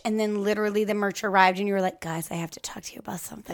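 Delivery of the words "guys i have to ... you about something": hushed, quick whisper. Retold or imitated. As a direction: whisper it like grave news. Retelling friend's serious announcement.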